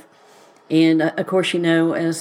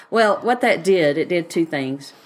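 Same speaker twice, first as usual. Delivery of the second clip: normal talking voice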